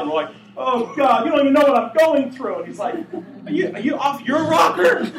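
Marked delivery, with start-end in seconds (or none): 0.5-2.6 high, loud mock wail; stressed-out complaint. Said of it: "we're all stressed out". 3.5-5.1 high, loud comic disbelief; incredulous shout. Acted. Acts God's incredulous reply.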